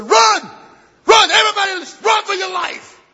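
high, loud battle cry; colonel's shout. Retold or imitated. Colonel yelling to his troops, loud and high. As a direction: scream the order to run. Colonel orders retreat in story.

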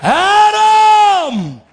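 long, high, loud call of the name; God yelling. Acted. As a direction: bellow it across the garden. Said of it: "He ain't never had to yell before".

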